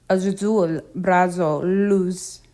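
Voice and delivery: Z in azul, brazo, luz like the S in sail. Shown as a demonstration. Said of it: "the S in sail".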